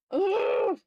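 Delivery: loud, high, strained cry of frustration; frustrated groan. Real, not acted. Streamer frustrated fixing layer mistake, loud anguished groan. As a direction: let out a real anguished ugh.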